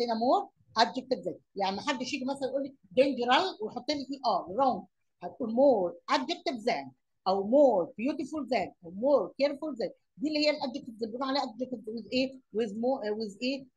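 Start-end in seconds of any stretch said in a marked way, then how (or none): 5.5-9.9 slow drilled rule. Shown as a demonstration. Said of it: "teacher drilling the rule".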